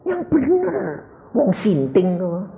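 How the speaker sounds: high, soft scoffing wife; incredulous wife. Retold or imitated. Wife scoffs at husband's claim of gold, high incredulous voice. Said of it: "Istri saya bilang gini".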